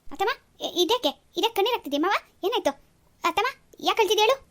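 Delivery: alarmed at seeing her in tears; worried surprise. Acted. Nephew alarmed to find his aunt crying, worried questions.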